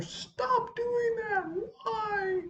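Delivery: high, drawn-out strained wail; mock yell. Teacher who lost their voice yelling, high drawn-out mock yell. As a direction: imitate yelling with the voice pushed way up. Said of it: "'cause I was yelling".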